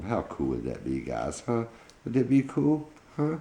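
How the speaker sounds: ordinary delivery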